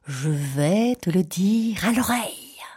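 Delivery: very slow coaxing crocodile voice; sly crocodile. Acted. Crocodile luring the child to his mouth. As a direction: drag it out, luring him in.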